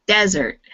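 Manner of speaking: "desert" with emphasis on the first syllable; "desert" front-stressed, falling at the end. Shown as a demonstration. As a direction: stress the start of "desert", DEZ-ert. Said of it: "the stress on the first syllable".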